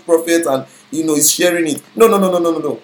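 ordinary delivery

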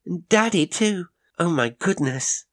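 put-on high child voice, delighted; a little girl thrilled to see daddy; excited child. A narrator's reading.

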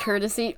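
"Courtesy" said as KER-tuh-see, no O sound. Shown as a demonstration. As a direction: drop the O from "Courtesy". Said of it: "Just pretend there's no O in it".

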